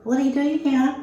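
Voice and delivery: a gentle, surprised what are you doing here; polite question. Shown as a demonstration. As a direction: ask it nicely, as if merely curious. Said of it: "it wasn't like".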